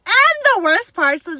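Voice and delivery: ordinary delivery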